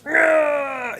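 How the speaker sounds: sudden high shout of a flustered anime guy; high startled anime yelp; anime flustered cry. Retold or imitated. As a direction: yelp like the anime hero overwhelmed by cuteness. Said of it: "his whole face turns red and he goes".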